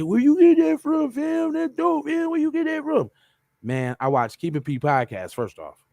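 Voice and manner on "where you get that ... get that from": high, excited falsetto of a hyped-up fan; admiring stranger. Retold or imitated. Strangers admiring the shirt, in high excited falsetto. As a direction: squeal it like someone who loves the shirt. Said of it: "They're gonna say".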